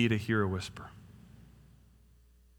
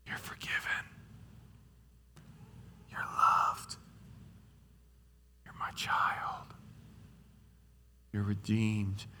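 God whispering truth; 11 dB quieter than usual. Acted. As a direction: whisper assurance, one truth at a time. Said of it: "He whispers truth".

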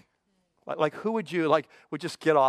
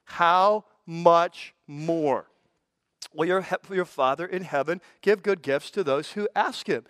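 "how much more" drawn out very slowly; slow, emphatic "how much more" in the verse; stress how much more; slower than usual. Real, not acted. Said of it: "underline the next three words".

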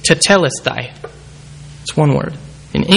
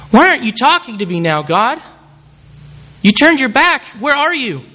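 anguished plea; 7 semitones higher than usual. Acted. Voicing Jesus abandoned, pleading.

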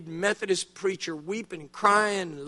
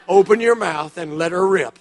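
put-on louder, laughing through the words as the old preacher; 8 dB louder than usual. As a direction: chuckle the advice out. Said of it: "He just started laughing".

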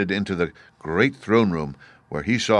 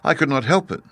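put-on high, artless child's voice; higher girl's voice, matter-of-fact; simple little girl; 7 semitones higher, 3 dB louder than usual. A narrator's reading. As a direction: answer simply, like a young girl. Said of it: "returned Dorothy simply".

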